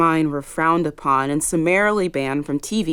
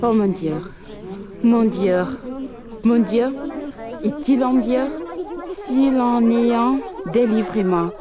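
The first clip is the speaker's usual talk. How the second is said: desperate plea; 7 semitones higher than usual. Acted. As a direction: beg for rescue, climbing higher. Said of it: "pleading to God in terror".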